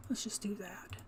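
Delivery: soft whispered aside. Real, not acted. Player deciding quietly while crafting, whispering. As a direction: whisper it to yourself. Muttering decision to herself.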